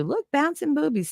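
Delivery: put-on high, excited kid's call; a light mock of a little boy pointing; imitating her son. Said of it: "my son's favorite too".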